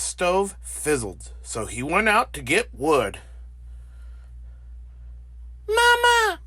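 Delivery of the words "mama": very high, piping chick call; baby gosling cry. Acted. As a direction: squeak it like a newborn gosling. Goslings calling the bear.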